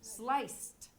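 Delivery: "sliced" as one syllable ending in st. Shown as a demonstration. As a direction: model "sliced" with a silent E. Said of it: "one syllable".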